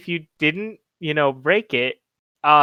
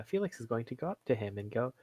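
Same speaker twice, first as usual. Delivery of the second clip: ordinary talk, nothing marked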